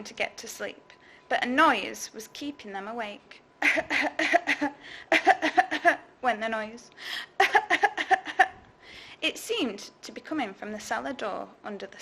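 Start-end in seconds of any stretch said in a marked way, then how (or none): 3.6-6.0 high cackling laugh; creature cackle. Acted. The thing's noise. 7.0-8.5 high cackling laugh; creature cackle. Acted. The thing's noise.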